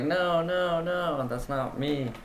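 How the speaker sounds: high, slow, put-on protest; mock sister denial. Quoting his sister's denial, slow high protest. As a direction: mimic the sister brushing it off, drawn out. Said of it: "she was like".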